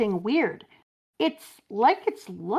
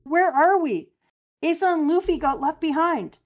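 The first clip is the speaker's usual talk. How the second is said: worried crewmate; 9 semitones higher, 5 dB louder than usual. Acted. Worried crew member calls out.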